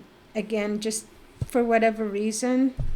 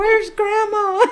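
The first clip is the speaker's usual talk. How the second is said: crying; 12 semitones higher, 10 dB louder, slower than usual. Retold or imitated. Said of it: "And we start crying".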